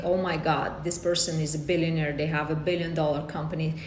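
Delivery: ordinary delivery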